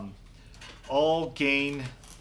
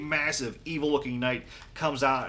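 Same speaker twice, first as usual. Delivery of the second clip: ordinary talk, nothing marked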